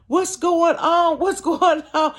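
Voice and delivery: put-on high, slow bewildered old woman; confused old lady. Blind old neighbor asking, confused.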